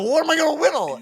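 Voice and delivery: very high, laughing protest; mock bewildered protest. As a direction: squeal it through a laugh. Acted whining reply, laughing.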